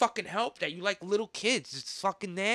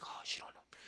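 hushed, breathy secret glee; gloating whisper; 17 dB quieter than usual. Retold or imitated. Creep gloating over secret photos at home, whispered.

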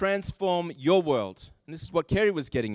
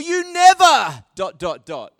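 loud, high, drawn-out "never"; mock-angry accusation; accusing spouse; 7 semitones higher, 9 dB louder, slower than usual. Arguing with a spouse, loud mock-angry "never".

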